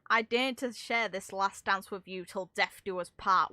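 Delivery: ordinary delivery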